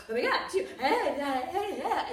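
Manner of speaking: loud, high, giggly mock freak-out; imitating starstruck fans. Restaurant staff freaking out at seeing her, loud giddy mock gush. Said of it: "they freak out".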